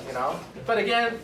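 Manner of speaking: ordinary talk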